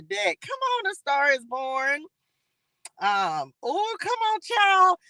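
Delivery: squealing come on; high excited cheer. Real, not acted. Host cheers the card drawn from the deck, squealing high.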